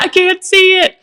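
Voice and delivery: high, loud frantic cry; imitating her own panic, high and loud; panicked refusal. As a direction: squeal it with eyes shut tight. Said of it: "And I'm like".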